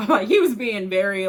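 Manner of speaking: words spilling through a real laugh. Real, not acted. Narrator laughing as he describes the rude landlord. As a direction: chuckle through the comment. Laughs while commenting on scene.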